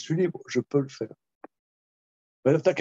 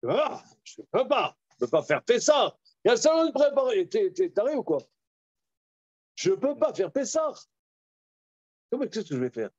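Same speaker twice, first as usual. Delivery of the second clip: higher, frantic protest; panicked businessman; 8 semitones higher, faster than usual. Retold or imitated. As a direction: squeal out the excuse in a panic.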